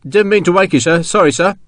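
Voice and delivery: rough soldier's voice, contrite and flustered. A narrator's reading. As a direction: apologise to the officer, stumbling over yourself.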